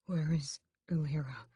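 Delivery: hoarse weak whisper. A narrator's reading. Weak Raoul asking brother, whispered. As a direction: whisper it weakly. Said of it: "he begins".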